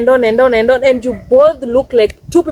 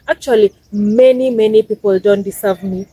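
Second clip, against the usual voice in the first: ordinary delivery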